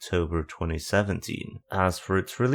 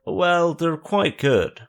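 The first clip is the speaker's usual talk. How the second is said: drawn-out, higher, louder mock praise; sarcastic praise; 9 semitones higher, 5 dB louder, slower than usual. Real, not acted. Vlogger mocking Frontwing's physical releases, sarcastic and drawn out. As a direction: lay the sarcasm on thick, slowly.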